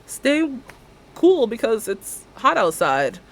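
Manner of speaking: high goodbye slowing at the end; awkward cheerful goodbye. Retold or imitated. Stylist saying goodbye to a stranger, high then slowing. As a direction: wave her off too cheerfully. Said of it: "I'm like".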